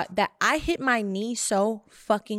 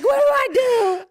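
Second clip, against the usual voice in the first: high, louder panicked question; frantic on the phone; mock panic; 12 semitones higher, 5 dB louder than usual. Panicking calling people.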